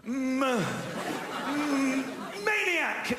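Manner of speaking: stammering m- m- then blurting maniac high; panic-spell, then burst out with the word; flustered spelling. Retold or imitated. Caller on the phone, stuck for a word, blurts it out.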